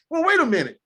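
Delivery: reporter challenge. Retold or imitated. Reporter challenging Saban, quoted.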